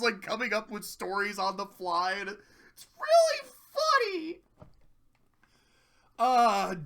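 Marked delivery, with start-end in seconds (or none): 2.3-4.4 high, loud, slow squeal of delight; drawn-out high-pitched glee; real amusement. Real, not acted. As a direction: squeal it, tickled by the story. Teller cracks up at own summary.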